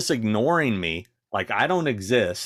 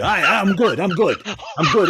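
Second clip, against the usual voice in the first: higher and louder, dismissive brush-off; mocking the rude fan; 11 semitones higher, 6 dB louder than usual. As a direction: play the pushy fan waving her away. Mimicking the fan brushing her off.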